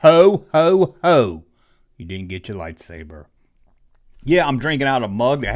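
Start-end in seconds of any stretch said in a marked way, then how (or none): 0.0-1.4 loud booming ho ho ho; hammed-up Santa laugh; mock Santa laugh. 2.0-3.2 quiet taunt. Acted. Repeated taunt, much softer.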